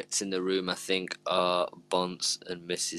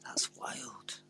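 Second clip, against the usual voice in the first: whispered; 5 semitones higher, 11 dB quieter than usual. Real, not acted. Stunned reaction, breathy.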